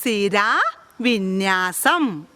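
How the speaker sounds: drawn-out model of സിരാവിന്യാസം; slow model word. Shown as a demonstration. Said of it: "ഒന്ന് പറഞ്ഞേ". (സാവധാനത്തിലുള്ള ഉച്ചാരണം)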